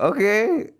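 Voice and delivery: meek okay. Retold or imitated. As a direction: accept it sheepishly. Meekly accepting the cake.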